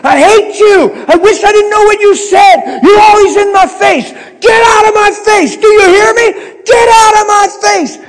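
all-out raging scream, very high and loud; screaming like a madman. Retold or imitated. Young man raging at his Bible, screaming like a madman. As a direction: scream at it like it's a person. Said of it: "I was screaming at my Bible like a madman".

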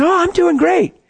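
imitated high-pitched girl's voice; chirpy little girl. Little girl at drive-in window answers, chirpy.

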